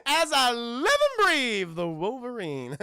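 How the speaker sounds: slow, high slimy greeting falling away at the end; impression of a slimy drawler, loud at first; slimy villain. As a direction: stretch it out like an oily villain. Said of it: "Juicy sounds so slimy".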